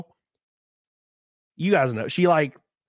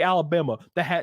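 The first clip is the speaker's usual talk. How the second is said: ordinary delivery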